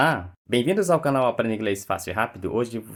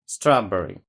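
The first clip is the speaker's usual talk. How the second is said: "Strawberry" with the first syllable punched louder; stress on first syllable; 5 dB louder than usual. Shown as a demonstration. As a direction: hit the opening of "Strawberry", let the rest fall away. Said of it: "colocar a entonação na outra sílaba".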